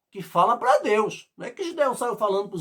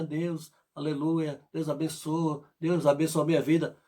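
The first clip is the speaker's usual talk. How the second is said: soft, lower, churchy recitation; formal prayer; 3 semitones lower, 5 dB quieter than usual. Retold or imitated. Preacher mocking a believer's formal prayer, soft and churchy. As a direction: pray by rote, politely empty. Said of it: "faz aquela oração bem formal".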